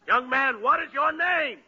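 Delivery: loud, high-pitched demand; impatient demand. Acted. Judge asks the young witness a third time, impatient. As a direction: raise pitch and volume, out of patience.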